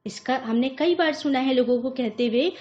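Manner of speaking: usual speaking voice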